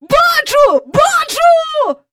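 very high, loud cries of discovery; elated high shouts; jumping with excitement. A narrator's reading. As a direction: cry out as if hopping for joy. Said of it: "аж підскочив він".

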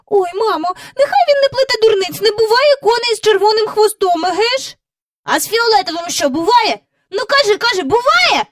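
put-on high, fast, loud child's cry; a girl squabbling fiercely. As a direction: sister argues with brother in a high child voice. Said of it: "скрикнув Майкл".

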